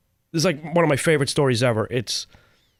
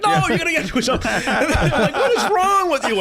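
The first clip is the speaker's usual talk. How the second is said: laughing disbelief; 12 semitones higher than usual. Retold or imitated. Soldiers urging him during air raid.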